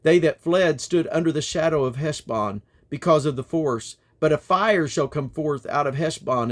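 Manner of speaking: steady, declaiming Bible reading; King James reading voice, lifted and measured; scripture reading. Acted. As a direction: proclaim the prophet's words to the congregation. Reading Jeremiah aloud to listeners.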